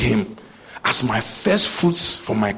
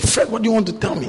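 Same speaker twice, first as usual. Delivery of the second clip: quick, pitched-up, impatient repeat of "Fred, what do you want to tell me?"; impatient asking; 9 semitones higher, faster than usual. Retold or imitated. Pressing his disciple Fred over kokonte, impatient and quick. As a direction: press him for the news.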